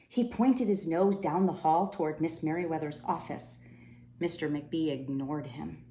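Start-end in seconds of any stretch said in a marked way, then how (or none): none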